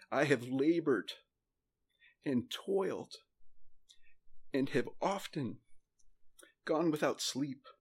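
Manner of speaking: real emotion, halting between phrases; emotional scripture reading. Real, not acted. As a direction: read haltingly, pausing to hold back feeling. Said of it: "get emotional about this".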